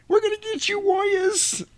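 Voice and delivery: very high, thin squeaky voice; a tiny man trying to sound tough; squeaky pipsqueak voice. Retold or imitated. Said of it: "he's a little pipsqueak".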